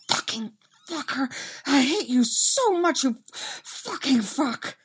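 high, breathless swearing on the run; panting curses. A narrator's reading. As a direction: curse between heavy pants while being chased. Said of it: "Dustin pants".

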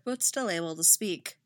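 usual speaking voice

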